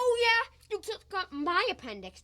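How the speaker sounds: loud, high, quarrelsome comeback; indignant retort. Acted. Patient answering the doctor's jibe, indignant retort. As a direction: fire back at an insult.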